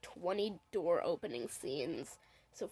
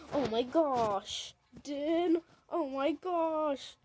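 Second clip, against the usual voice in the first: slow gasping awe; 7 semitones higher, much slower than usual. Retold or imitated. Kid voicing shocked character.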